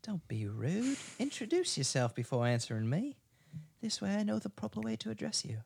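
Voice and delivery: a hint of a stern English host, lightly voiced; [name] scolds the rude burglar in English accent. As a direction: scold politely in English tones. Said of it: "that English one".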